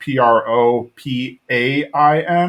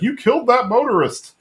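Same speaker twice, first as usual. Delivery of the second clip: appalled accusation in a high voice; Hank; 11 semitones higher than usual. Retold or imitated. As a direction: imitate a scandalized cartoon dad, pitched way up. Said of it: "and Hank's like".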